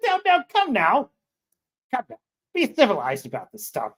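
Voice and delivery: mock British scolding. Plays scolding civilized Briton.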